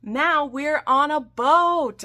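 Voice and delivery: high, loud and slow, stretching out every word; excited announcement. Acted. Recapping the ship scene for viewers, grandly proclaimed. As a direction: belt it like a big proclamation.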